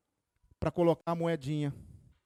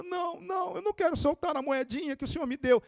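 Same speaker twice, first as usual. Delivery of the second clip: put-on high pleading child voice, much higher; little girl's voice; 12 semitones higher than usual. Little girl refusing her grandpa, high and pleading. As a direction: whine like a small girl refusing.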